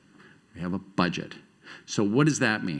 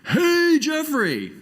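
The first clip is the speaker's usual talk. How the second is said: loud, high, drawn-out holler imitating a boss; booming mock shout of greeting; huge bellowing voice; 4 dB louder, much slower than usual. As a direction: bellow it like hailing someone across a crowded park. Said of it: "this huge bellowing voice".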